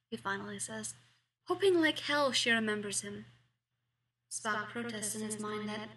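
usual speaking voice

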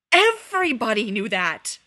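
full, exasperated shout. A narrator's reading. Sirius, flinging his arms about at Remus, shouts in exasperation. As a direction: yell it across the bathroom in disbelief. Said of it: "Sirius shouted".

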